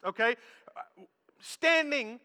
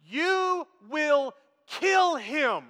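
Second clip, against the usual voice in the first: very slow, each word stretched out; drawn-out warning; 3 semitones higher, 3 dB louder, much slower than usual. Retold or imitated. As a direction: spell out the warning word by word, hugely slowly. Stressed warning to the big man.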